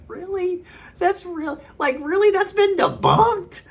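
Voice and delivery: very high-pitched, squeaky mock disbelief; incredulous. Doubter reacting to claim that science is debunked, incredulous. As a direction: exaggerate your astonishment, slowing on the last question.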